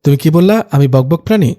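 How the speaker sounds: ordinary delivery, nothing marked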